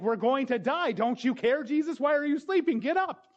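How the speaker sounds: high, loud frantic cry of panic; panicked disciples. Retold or imitated. Disciples yelling at sleeping Jesus in the storm, panicked. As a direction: play the terrified disciples shouting at Jesus.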